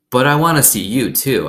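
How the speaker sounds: put-on a little girl begging; pleading girl. A narrator's reading. Opal pleads in story.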